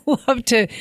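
laughing. Real, not acted. Laughing at megaphone joke.

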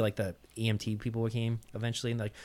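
ordinary talk, nothing marked

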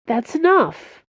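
high-pitched appeal to stop; pleading. A narrator's reading. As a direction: plead with a friend to leave it. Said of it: "he said in a pleading voice".

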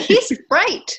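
mock kids agreeing. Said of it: "They're like".

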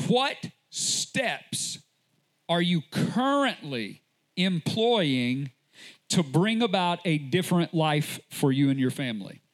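usual speaking voice

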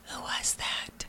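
slow, hushed whisper; very soft, unvoiced-sounding whispered question; whispered punchline. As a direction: whisper it like an uneasy nurse. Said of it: "whispered three words".

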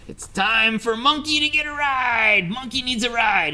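high, bright-eyed monkey voice, stretching into a lazy yawn; a smug little monkey announcing nap time; cheeky monkey voice. Acted. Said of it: "the monkey says".

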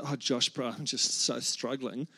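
low, soft, dragging moan; Mitch. Acted. Friend having a grumpy week confides in his pastor mate, weary impression. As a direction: put on a weary mate's voice, low and slow. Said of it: "that's how he talks. That was banging Mitch".

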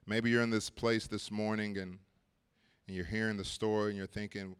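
pastor inviting the congregation forward, gently; gentle altar call. Acted. Altar call with heads bowed.

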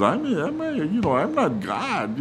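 high-pitched exasperated retort; mock exasperation, pitch leaping up; snapping. Jacob snapping at Rachel's demands, high exasperated protest. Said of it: "he just snapped and says".

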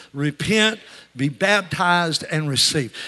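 loud chant, drawing out "baptized"; preacher's call-and-repeat. Real, not acted. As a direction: drive each phrase home for the congregation to echo. Leads congregation in repeating the phrase.

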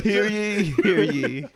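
high, loud royal proclamation; town crier. Pretend. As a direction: proclaim like a herald. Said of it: "like the fucking kings used to do".

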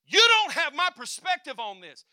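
very loud, high rebuke; preaching shout. Acted. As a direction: thunder it at the room.

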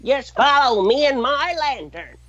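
high, lightly voiced old prospector. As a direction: play a grizzled old prospector. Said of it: "Old Prospect is the greatest skit".

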